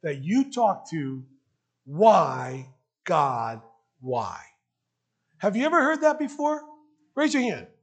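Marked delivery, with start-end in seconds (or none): 1.8-4.4 low, pained plea of a grieving person; anguished why god. Retold or imitated. Pastor voicing a sufferer's plea to God, low anguish. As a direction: cry out the question in low anguish. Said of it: "a question that everyone I ask".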